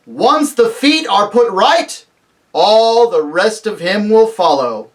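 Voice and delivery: loud, slow, joyful proclamation; joyous shout. Acted. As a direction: call it out high and slowly. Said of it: "shouted Aslan joyously".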